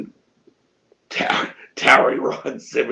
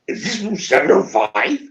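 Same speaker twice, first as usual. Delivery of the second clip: higher, rising question; startled repeated question; 4 semitones higher than usual. Retold or imitated. Friend asking once more as it hits him, startled and higher. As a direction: ask it with mounting realization.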